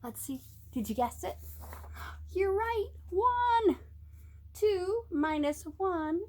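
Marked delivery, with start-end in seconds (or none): none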